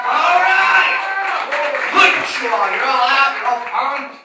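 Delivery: loud, high opening shout to the audience; rousing stage greeting; hyping the crowd. Real, not acted. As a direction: call out big and punchy to the room. Comic calls out to the crowd.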